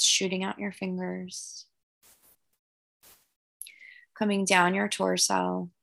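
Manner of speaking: calm guided breathing voice. Acted. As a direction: gently lead the breathing. Leads guided meditation visualization.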